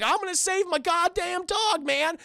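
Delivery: high, determined cartoon slacker; Shaggy. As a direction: declare it in a high sidekick voice. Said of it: "Shaggy's like".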